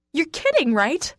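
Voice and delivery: yell. Acted. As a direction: yell it back in outrage. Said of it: "I yell".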